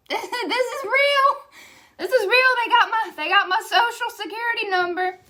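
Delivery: panicked disbelief. Retold or imitated. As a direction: squeal it like the panic just hit. Retold panic over social security.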